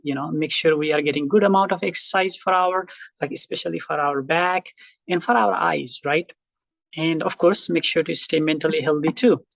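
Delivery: normal talking voice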